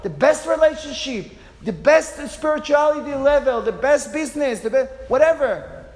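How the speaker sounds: loud, high motivational push; fired-up coach. Retold or imitated. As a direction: drive him up the mountain with your voice. Coach's pep talk quoted.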